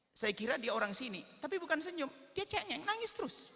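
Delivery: shepherd's voice. Acted. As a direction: tell it in a high, singsong shepherd voice. Shepherd describes the crying boy.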